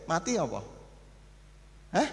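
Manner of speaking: ordinary delivery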